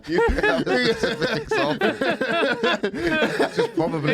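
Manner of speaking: talking through a high laugh. Real, not acted. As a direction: laugh out the words. Laughs while speaking.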